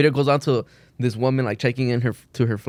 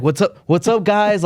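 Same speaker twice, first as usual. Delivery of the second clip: higher, bubbly influencer hello; vlogger greeting; 7 semitones higher, 3 dB louder, slower than usual. Retold or imitated. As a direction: open like a chirpy vlogger. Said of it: "She's like".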